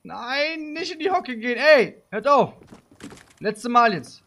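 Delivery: high, rising to a loud bark; scolding. Pretend. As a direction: tell off disobedient troops, high and loud. Players crouch before being told. (aufgebrachtes, lautes Zurechtweisen)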